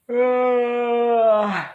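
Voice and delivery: high loud yawning "Uh"; imitating his own yawn; mock yawn. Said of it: "every time I go".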